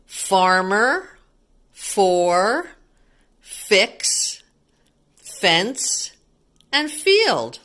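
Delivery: each word said alone, leaning on the "F" at the start of "Farmer", "for", "fix", "fence", "field"; slow list that punches the opening "F" of each word; stressing the F sound. Shown as a demonstration. Teacher to children, showing the F sound in "Farmer" and "fence". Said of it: "Did you see all those letter Fs".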